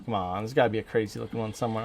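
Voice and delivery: ordinary talk, nothing marked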